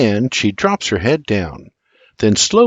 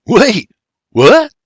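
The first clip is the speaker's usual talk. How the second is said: high, louder, baffled double take; startled; 10 semitones higher, 6 dB louder than usual. A narrator's reading. William calls after departing Mary, startled and confused. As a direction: blurt it out, rising in disbelief.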